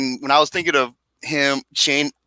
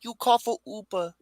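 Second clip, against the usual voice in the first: a quiet, higher mock broken English; 6 semitones higher, 7 dB quieter than usual. Friend mocking the family's line for the group, soft put-on broken English. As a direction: tease it in a halting put-on accent.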